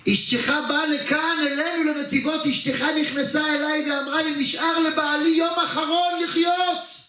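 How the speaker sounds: high-pitched, insistent retelling; impassioned retort. Acted. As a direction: build it in one insistent run, drawing out the last words. Acted indignant reveal to husband.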